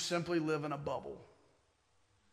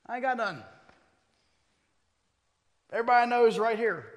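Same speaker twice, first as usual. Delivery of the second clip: bad Southern accent; 6 semitones higher, 7 dB louder, faster than usual. Pastor's kid answering the Easter invite in a bad Southern accent. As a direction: put on a corny, higher-pitched Southern accent. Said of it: "bad of a Southern accent".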